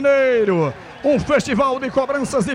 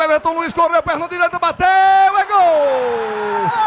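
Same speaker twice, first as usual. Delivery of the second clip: explosive goal commentary; 3 semitones higher than usual. Real, not acted. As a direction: yell the goal, stretch it long. Decisive goal, crowd erupts.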